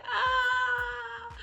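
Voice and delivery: high, loud cry of dismay; anguished cry. Retold or imitated. The reviewer reacts to the sister's death with a high, loud cry. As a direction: wail as if your heart just broke. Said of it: "And I'm like".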